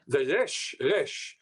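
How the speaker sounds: resh rolled in the throat like a uvular R; resh with the back-of-throat Yiddish R; Israeli resh. Shown as a demonstration. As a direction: say resh with a guttural, German-style R. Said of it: "which comes from Yiddish and from German".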